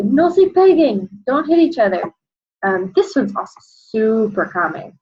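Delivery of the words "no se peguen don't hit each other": put-on higher, louder warning to two children; teacher warning two children; telling two kids off. Shown as a demonstration. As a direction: stop two kids fighting. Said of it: "I talk to two people and I'll say".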